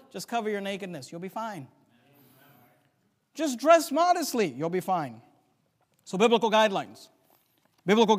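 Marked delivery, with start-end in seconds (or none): none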